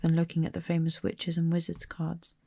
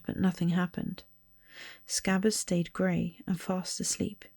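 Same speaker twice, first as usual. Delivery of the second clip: plain reading voice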